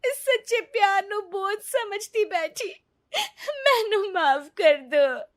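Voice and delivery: high, crying apology; tearful plea. Acted. Neeta begging husband and mother-in-law to forgive her, crying. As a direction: beg forgiveness through tears.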